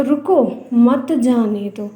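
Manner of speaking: slow "रुको", pause, then "मत जाने दो"; "रुको" set apart, then "मत जाने दो" falling at the end; pause after रुको. Shown as a demonstration. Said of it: "तो ये विराम चिन्ह है".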